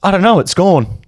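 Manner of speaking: high-pitched, lost-thought reply; imitating a stoned uncle. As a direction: let the thought slip away, voice high. Imitates uncle losing his thought.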